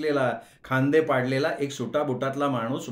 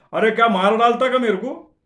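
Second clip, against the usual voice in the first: much higher and louder Bombay Hindi shout; angry yell; 8 semitones higher, 8 dB louder than usual. Acted. Man lying on the rack yells at the narrator, loud angry shout. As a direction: yell as something lands on you. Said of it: "एक माणूस ओरडला".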